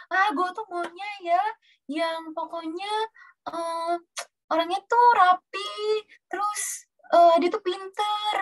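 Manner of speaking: mock a choosy single listing demands; picky person. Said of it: "Kadang orang kayak".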